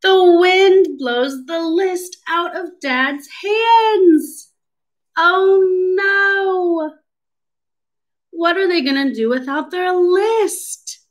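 high-pitched, theatrical storybook dismay; mock alarm. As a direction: play up the disaster for small children. Acting alarm at lost list.

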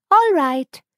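put-on high, loud, cheerful girl's reply; eager little girl. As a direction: chirp a bright yes.

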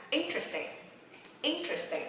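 "Interesting" in one quick go, high first syllable, falling; quick reading. Shown as a demonstration. Teacher speeding the drill up for learners, quick "Interesting". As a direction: run "Interesting" together fast. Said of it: "Đọc nhanh ha".

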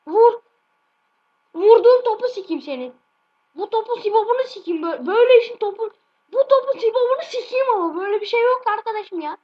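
high, loud raging yell at the ball; real gamer rage; furious shout. Real, not acted. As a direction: explode at the missed chance. Raging over missed shot.

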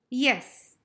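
normal talking voice

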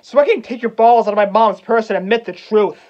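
loud, heated outburst; angry demand. Acted. Hamlet demands his uncle admit the truth, angry and loud. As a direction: raise your voice and demand a confession.

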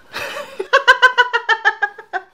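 high, loud run of laughter; long cackle. Real, not acted. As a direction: crack up helplessly in a high cackle. Cracks up about the rats.

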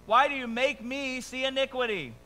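high, loud scripture complaint; protesting. Acted. Habakkuk questioning God over evil, protesting.

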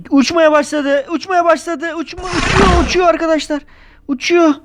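high, breathless live commentary of a flying dragon; excited narration. Pretend. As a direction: call it like an amazed sports announcer. Pretend dragon flying, kids watching.

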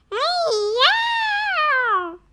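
high drawn-out cat cry. Acted. Strange cat on the doormat yowling at Rubber. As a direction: yowl like a cat. Cat with big green eyes.

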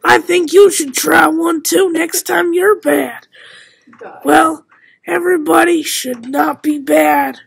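loud, hammed-up advert voice; loud mock pitch. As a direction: sell it loudly like a kid's commercial. Loud mock ad about punishment.

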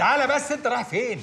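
high-pitched, urgent call to stop; urgent calling back. Acted. Stopping a friend who storms off, urgent calling back. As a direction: call after him urgently, voice jumping up.

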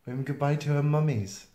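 ordinary delivery

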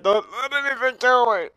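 high-pitched frantic plea; panicked plea. Real, not acted. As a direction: beg in a rising panic. Pleading for survival in game.

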